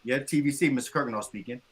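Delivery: low office phone pickup; low, understated professional greeting; work phone answer. Shown as a demonstration. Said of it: "I can't just be like".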